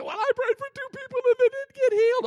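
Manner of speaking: high, loud mocking complainer voice; whiny complainer. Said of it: "He said".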